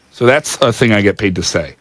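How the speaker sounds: usual speaking voice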